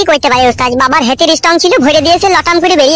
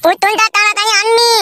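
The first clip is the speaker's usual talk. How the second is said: put-on high, pleading child's voice; whiny little boy; 8 semitones higher, slower than usual. Small son begging father for big doll, whining. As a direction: beg like a spoilt kid.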